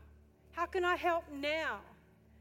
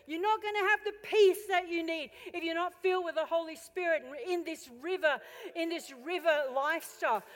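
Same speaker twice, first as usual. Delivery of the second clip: loud, rising preacher's cry, pushing harder; preaching at full force; 5 semitones higher, 10 dB louder than usual. Acted. Preacher voicing the Holy Spirit's cry to the church, full-force preaching. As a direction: hammer it home like a revival call.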